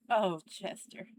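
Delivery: normal talking voice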